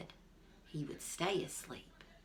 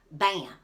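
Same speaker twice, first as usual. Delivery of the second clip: loud sharp shot sound; gunshot bam; 9 dB louder than usual. A narrator's reading. Shootwell fires at the far squirrel, a loud bam. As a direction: bark it out like a rifle crack.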